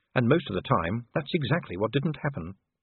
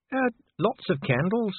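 high-pitched, reluctant dwarf; muttering Dee; 10 semitones higher than usual. A narrator's reading. Dwarf answering the duchess while resenting her husband, muttering. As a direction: give a grudging answer in a high voice. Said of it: "Dee muttered, glaring at Vimes".

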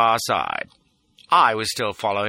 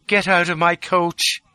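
slow, higher-pitched icy command; very cold; 8 semitones higher, 4 dB louder, slower than usual. A narrator's reading. Lola turning the fugitive out of her coach, cold. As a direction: order someone out without sympathy. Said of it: "says she, very cold".

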